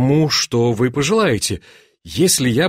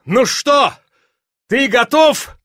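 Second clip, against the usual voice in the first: high-pitched call; excited, raised question; eager challenge; 10 semitones higher, 4 dB louder, slower than usual. A narrator's reading. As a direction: call out, itching to begin. Duke calls challenge from horseback.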